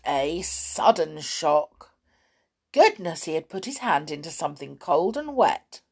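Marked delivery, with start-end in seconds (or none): 2.7-3.2 startled gasp. A narrator's reading. Sailor startled.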